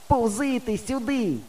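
high, slow drawn-out call; distant hollering. A narrator's reading. Friend calls the crawling boy over, distant hollering. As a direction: holler slowly so it carries. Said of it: "гукає".

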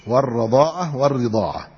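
ordinary talk

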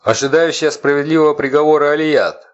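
loud, high haughty proclamation; haughty herald. A narrator's reading. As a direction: announce it from above like a herald. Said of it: "надменный голос глашатая".

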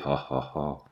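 low, soft, forced "ha, ha, ha"; forced laughter. A narrator's reading. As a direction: fake a mirthless laugh, deep and quiet. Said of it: "un nouvel accès de gaieté forcée". (rire forcé, grave et sourd)

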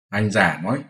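ordinary delivery

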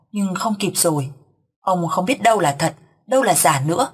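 usual speaking voice

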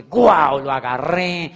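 "Guau" soltado de golpe, mucho más fuerte; exclamación de júbilo. Retold or imitated. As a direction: grita de alegría al descubrirlo. Ser humano exclama al encontrar camino. (exclamación emocionada y fuerte)

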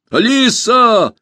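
high, long-drawn shout; loud, high call on the run. A narrator's reading. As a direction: holler the name across a distance. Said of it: "кричал он на бегу".

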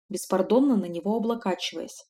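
ordinary delivery, nothing marked

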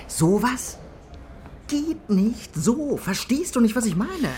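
lauter und höher, Sowas geht nicht so langsam betont; eindringlich. Acted. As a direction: mit Nachdruck überzeugen wollen. Konefke redet eindringlich auf ihn ein. (Konefke redet dem Kollegen eindringlich ins Gewissen, laut)

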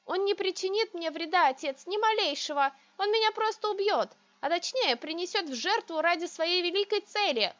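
high, loud hysterical pleading; losing control, on the edge. A narrator's reading. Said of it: "скатываюсь до банальной истерики". (на грани истерики)

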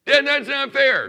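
put-on high, loud childish protest; nasal whine. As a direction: whine it like a wronged kid. Child protesting father's count.

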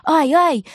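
high sharp ouch from hail stinging; two quick pained ouches; yelp of pain. A narrator's reading. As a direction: cry out as ice hits your face. Hail hits girl's face.

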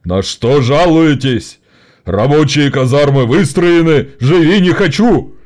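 slow, loud, hoarse yelling; straining shout. A narrator's reading. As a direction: bellow at the workers. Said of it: "надрывать глотку". (надрывно кричит)

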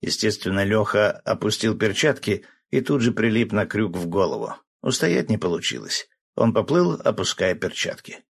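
ordinary delivery

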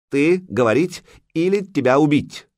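broken-Russian threat. A narrator's reading.

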